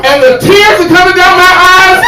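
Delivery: high, loud disbelieving yell; incredulous shout. Acted. Describing the worst singing of his life, shouting in disbelief. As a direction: shout it like the singing still hurts.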